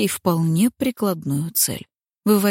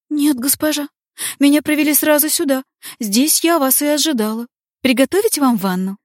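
gentle maid voice; 8 semitones higher, 5 dB louder than usual. Acted. Диалог Бранки и Линет.

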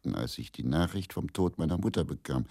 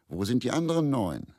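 higher, teasing joke question; mock joking; 9 semitones higher, 4 dB louder than usual. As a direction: toss it off as a playful jibe. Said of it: "Im Spaß natürlich". (spöttisch im Spaß gefragt)

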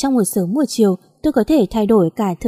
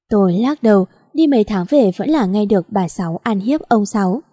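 ordinary delivery

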